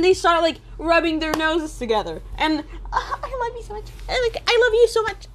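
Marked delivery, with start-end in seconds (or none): 2.9-5.3 mock lovey couple.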